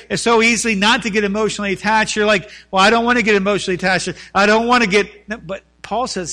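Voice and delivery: loud, high-pitched pulpit delivery; impassioned preaching. Acted. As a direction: hammer each repeated phrase home. Preaches at full force.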